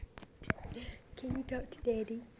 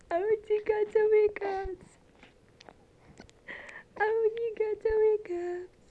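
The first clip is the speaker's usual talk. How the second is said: loud coo; 6 semitones higher, 7 dB louder than usual. Real, not acted. Cooing at baby.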